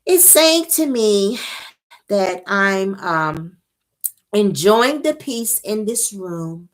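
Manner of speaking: high, slow, airy-calm imitation. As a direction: float it out serenely, slowly. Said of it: "that's how she's saying it, very calm".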